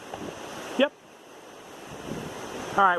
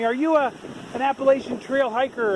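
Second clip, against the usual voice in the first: higher-pitched mild mock yell; retold yell; 6 semitones higher than usual. Excited motorist calling to a hiker, mild retold yell. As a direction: imitate someone calling out, only half raised. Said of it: "he yells at me".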